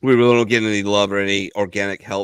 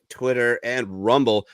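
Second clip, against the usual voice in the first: normal talking voice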